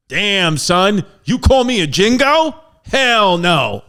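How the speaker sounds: an offended president sassing back; indignant McKinley. Retold or imitated. McKinley's indignant reaction acted out.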